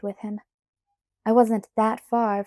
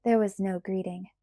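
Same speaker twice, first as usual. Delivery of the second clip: usual speaking voice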